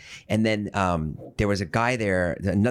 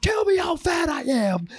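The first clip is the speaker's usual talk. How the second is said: begging shout. Retold or imitated. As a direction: yell it, begging to be mocked. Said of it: "Like that".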